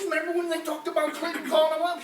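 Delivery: normal talking voice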